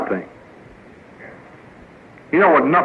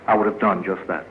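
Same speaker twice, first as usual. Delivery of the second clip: ordinary talk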